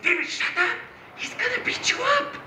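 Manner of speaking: high, panicky hushed warning; acted alarm. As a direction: shut him up in a squeaky panic. Troops scared, silencing David.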